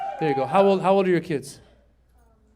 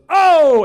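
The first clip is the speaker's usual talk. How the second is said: loud, high startled "oh"; father's shocked yelp, acted; startled cry; 10 semitones higher, 13 dB louder than usual. Father walks in on son.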